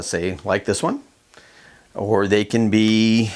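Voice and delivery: ordinary delivery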